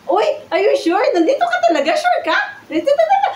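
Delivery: high-pitched, flustered questioning; shocked disbelief. Acted. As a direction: squeal the questions as if caught off guard. Stunned caller learns he has arrived. (gulat na gulat, mataas ang boses)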